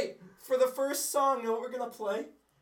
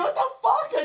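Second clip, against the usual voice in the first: shocked high yell; laughing startled shout; 12 dB louder than usual. Retold or imitated. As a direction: blurt it out loud and high with a laugh. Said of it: "I just yelled that".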